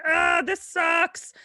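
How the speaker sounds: high, drawn-out comic cry; mock anguished wail. Daddy Cop in his electric chair nightmare, mock wail. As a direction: wail it in hammed-up dismay. Condemned man wailing in nightmare.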